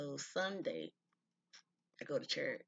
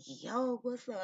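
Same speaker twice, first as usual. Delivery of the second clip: high, loud friendly call across the yard; 10 semitones higher, 6 dB louder, slower than usual. Retold or imitated. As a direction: shout a greeting to someone arriving. Calling out to brother's car.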